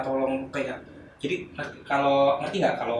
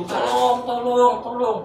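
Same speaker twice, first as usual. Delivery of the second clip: high-pitched mock cry for help; long, high call; screaming for help; 10 semitones higher, much slower than usual. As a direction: re-enact a drawn-out yell. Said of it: "Sambil teriak".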